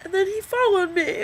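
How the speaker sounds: real tears, voice high and breaking; crying. Real, not acted. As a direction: sob out the words. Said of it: "I'm crying into a video".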